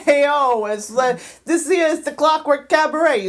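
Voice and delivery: brash mock-Jersey accent. Shown as a demonstration. Introducing the show to Americans, Jersey accent. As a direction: call it out in a put-on Jersey accent. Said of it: "I can do Jersey".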